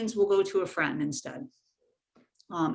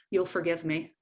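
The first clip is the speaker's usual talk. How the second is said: normal talking voice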